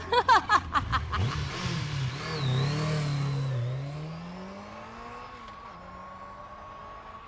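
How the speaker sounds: long gloating villain's cackle; evil laugh. Acted. The villainess gloats over the captured girl with a long evil laugh. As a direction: cackle on and on in triumph.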